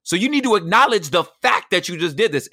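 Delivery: high and loud, hammering the point; firm indignant retort. Retold or imitated. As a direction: insist she owns what she did. Retold argument with wife.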